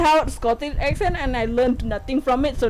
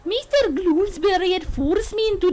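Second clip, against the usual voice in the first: attempted Scottish accent, lilting; 3 semitones higher than usual. Bride recounts being forced along, in an attempted Scottish accent. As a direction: put on an amateur Scottish brogue. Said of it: "It's just her accent".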